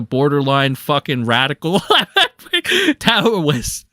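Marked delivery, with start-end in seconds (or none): 1.8-2.9 high loud laugh; sudden laugh; burst of laughter. Real, not acted. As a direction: crack up. Laughs at own joke.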